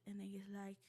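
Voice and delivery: ordinary talk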